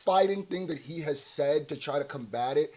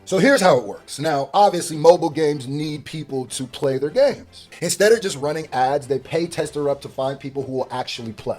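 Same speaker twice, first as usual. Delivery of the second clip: upbeat sponsor pitch, louder and punchy; ad read; 8 dB louder than usual. Acted. Streamer pitching app to his viewers, upbeat ad read. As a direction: sell it to the viewers like a hype ad.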